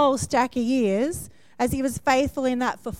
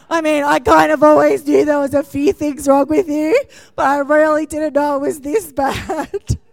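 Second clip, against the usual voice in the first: laughing; 4 semitones higher, 7 dB louder than usual. Real, not acted. Teasing husband while laughing.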